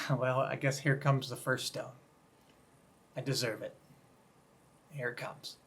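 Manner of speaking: the woman bracing for the stone, giving up; a hushed voice for the woman who thinks she deserves it; resigned dread. Retold or imitated. Accused woman awaiting the stone.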